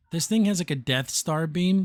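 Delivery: ordinary talk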